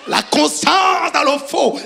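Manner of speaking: preacher landing a joke loudly; shouted punchline. Acted. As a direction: deliver the punchline loud and slow.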